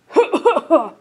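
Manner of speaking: sudden loud cough. Acted. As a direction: cough hard out of nowhere. Bear puppet stirs.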